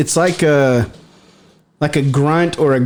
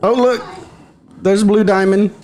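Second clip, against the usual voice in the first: excited; 5 semitones higher than usual. Real, not acted. Greeting the cat excitedly.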